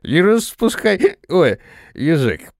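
slow, slightly slurred tipsy voice; slightly slurred speech. A narrator's reading. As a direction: speak a little drunk and slurred. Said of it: "слегка заплетающимся языком".

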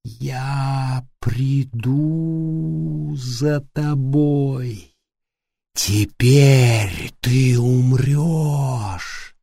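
slow, eerie, drawn-out menace; whisper-threat like a horror villain; creepy voice. A narrator's reading. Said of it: "прервал её жуткий голос".

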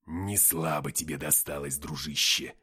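low, heavy character voice; low-pitched, forceful commiseration; hearty comrade. A narrator's reading. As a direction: address a battered pal, low and weighty. Victor addresses battered friend.

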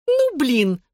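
high excited squeal. A narrator's reading. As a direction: squeal it out in shock. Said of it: "завизжала Клэр". (визгливо, высоко)

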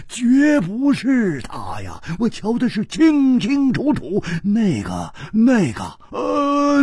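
high, agitated outcry; 叫起来. Acted. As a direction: cry out in protest, stretching the first denials. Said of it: "胡铁花叫起来说". (激动地高声叫嚷)

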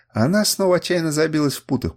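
plain reading voice

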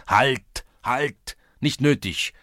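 high, loud interruption; warding off. A narrator's reading. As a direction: cut the reader off urgently. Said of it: "wehrte ihm der Schulze". (laut und hastig abwehren)

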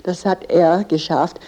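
ordinary talk

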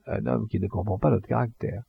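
ordinary delivery

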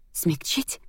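incredulous question, rising at the end; a touch of mocking amusement, pitched above his answer; scornful laughing echo. A narrator's reading. Heroine throwing his word back, mildly laughing echo. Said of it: "рассмеялась я".

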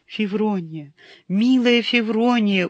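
loud, high sobbing cry; sobbing lament. Acted. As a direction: wail her name through tears. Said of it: "уже даже не молился, а просто жаловался он".

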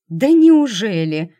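loud, slow sarcastic jab; drawn-out mocking retort; sarcastic hiss. A narrator's reading. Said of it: "прошипела девушка".

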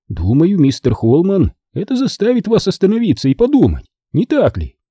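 a hint of smug mockery; ехидный Тино. A narrator's reading. Tino taunts Holman. (слегка ехидно)

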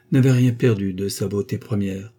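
ordinary delivery, nothing marked

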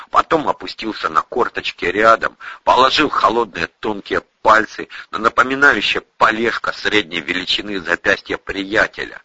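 ordinary delivery, nothing marked

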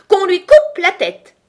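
very high, very loud slow scream; full-force yell. A narrator's reading. The queen condemns Alice at the top of her voice, yelling. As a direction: bellow the order, stretching the words. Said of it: "hurla la reine de toutes ses forces".

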